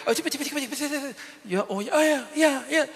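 put-on high, excited little-girl voice; squeaky child chattering fast; daughter's chatter. Father imitating his small daughter's mall chatter, high and excited.

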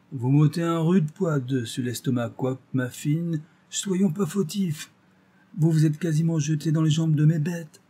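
a hint of a relieved country carter; country market gardener. A narrator's reading. Market gardener voiced by narrator.

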